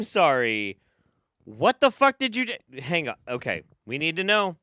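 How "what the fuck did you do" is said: outraged. Real, not acted. Outraged at racist exorcist line.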